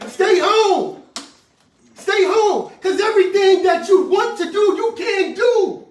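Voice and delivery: loud, high insistent preaching; preaching shout. Real, not acted. Preacher warning congregation to stay home, loud shout. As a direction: hammer the command home.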